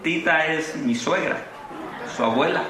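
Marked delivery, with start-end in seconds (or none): none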